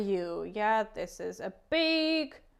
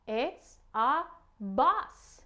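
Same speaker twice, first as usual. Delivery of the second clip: very slow, rising at the end; slow model word; much slower than usual. Shown as a demonstration. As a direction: model it slowly for a toddler. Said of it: "So can you say it?".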